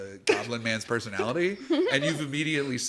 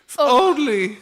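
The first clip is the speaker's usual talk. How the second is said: high, loud hinting plea; hint hard that they should help; pleading goblin; 7 semitones higher, 6 dB louder than usual. Acted. Goblin fishing for help from strangers, high and pleading.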